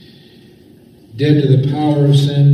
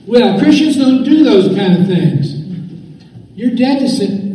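high put-on accuser with a hint of Southern accent; Satan; 7 semitones higher than usual. Devil needling a believer about sin, high Southern-tinged taunt. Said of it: "Does he have a southern accent?".

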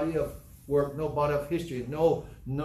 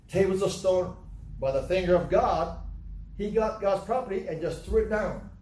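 ordinary delivery

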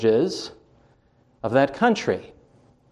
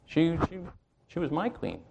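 dropping softer, halting over the words; quiet and moved; 2 semitones higher, 11 dB quieter than usual. Real, not acted. Canadian-born pastor telling the congregation about his queen, softly. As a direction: let it fall to a near hush, personal.

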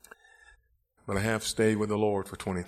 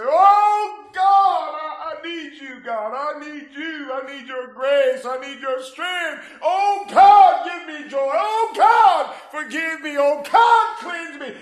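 high, loud, crying-out plea to God; a sinner's loud, desperate altar prayer; pleading prayer at the altar; 15 dB louder, slower than usual. Acted. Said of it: "get to the altar and say".

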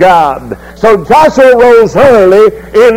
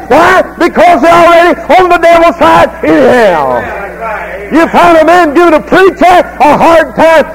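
fiery preaching; 5 semitones higher than usual. Acted. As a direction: preach it hard to the congregation. Preacher at full force.

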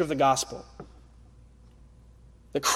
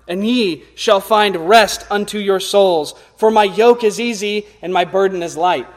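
scripture reading; 4 dB louder than usual. Acted. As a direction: proclaim the verses to the congregation. Reading Jesus' words aloud.

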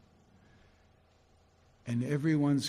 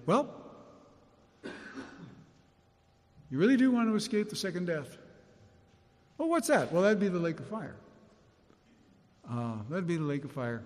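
offhand casual; 4 semitones higher than usual. Retold or imitated. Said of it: "he just mentions it like".

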